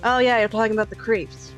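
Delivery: ordinary talk, nothing marked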